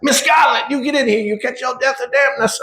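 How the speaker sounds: mock the Gone with the Wind Southern maid, scolding; exaggerated Southern accent, calling someone indoors. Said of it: "Gone with the Wind ... talking like this".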